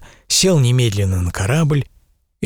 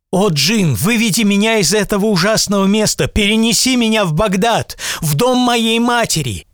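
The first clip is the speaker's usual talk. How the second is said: put-on high, pleading child's babble; a scared boy begging the genie; frightened boy; 10 semitones higher than usual. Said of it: "Испуганный мальчик пролепетал".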